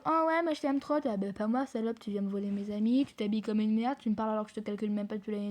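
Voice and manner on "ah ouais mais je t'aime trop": higher-pitched fake-friendly imitation; mock a girl gushing affection; mimicked gushing classmate. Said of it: "la meuf, elle vient de dire". (imitation moqueuse d'une fausse copine)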